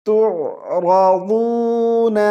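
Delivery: تعرضون chanted very slowly; tajweed pronunciation. Shown as a demonstration. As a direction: draw out تعرضون in recitation. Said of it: "کیسے پڑھیں گے؟".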